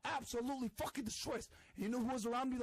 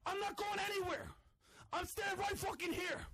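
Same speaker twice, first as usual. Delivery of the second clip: higher defiant shout; angry shouting; 8 semitones higher than usual. Real, not acted. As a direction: plant your feet and yell. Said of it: "losing his mind".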